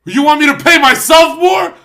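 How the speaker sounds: outraged designer building to a shout; insulting rant. Retold or imitated. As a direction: berate a dumb business partner. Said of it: "I'm like".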